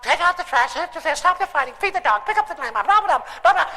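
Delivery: high, rattled-off nagging list; mock nagging. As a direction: drone the chores like an endless nag.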